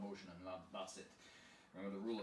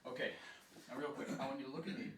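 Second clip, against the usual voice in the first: ordinary talk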